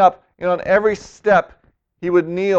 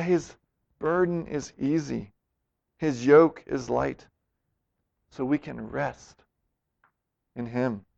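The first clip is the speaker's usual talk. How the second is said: soft, settling close; 5 semitones lower, 8 dB quieter than usual. Real, not acted. Closing reassurance.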